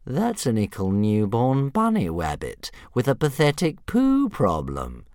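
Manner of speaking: loud, high sneer, slowing on poo problem; bully dragon mocking in baby talk. A narrator's reading. Fireworm mocking.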